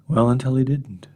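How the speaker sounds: ordinary talk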